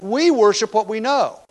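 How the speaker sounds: "We" pressed high and loud; stress on WE. Shown as a demonstration. Preacher quoting Jesus, stressing "We" loud and high. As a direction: lean hard on "We". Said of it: "is just as emphatic as the "you".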